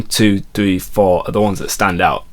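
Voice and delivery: ordinary talk, nothing marked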